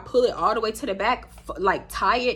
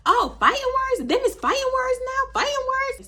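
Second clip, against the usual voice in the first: very high incredulous repeats; hammed-up shocked squeal; mock disbelief. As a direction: act shocked, pitch way up. Mock outrage at fighting words.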